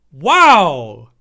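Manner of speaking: loud, deep mock amazement; sarcastic wow. Real, not acted. Mocking the heroes' useless attacks, sarcastic loud wow. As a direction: drip sarcasm into it.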